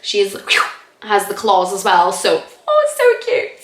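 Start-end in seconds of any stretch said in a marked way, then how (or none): 2.7-3.6 high-pitched coo over something cute; gushing. Real, not acted. Vlogger gushing over the little girl from Logan, gushing. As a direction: gush in a much higher voice.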